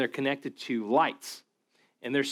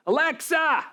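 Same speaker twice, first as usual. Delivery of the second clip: high, loud yell of a name; mock yell to wake the speaker; 14 semitones higher, 4 dB louder, slower than usual. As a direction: shout it across the room at the device. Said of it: "you have to yell at her".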